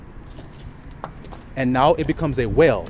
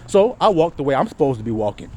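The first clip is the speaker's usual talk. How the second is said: normal talking voice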